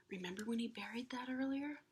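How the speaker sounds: quick hushed reminder; whispered aside. Real, not acted. Reader reminds the children in a whisper.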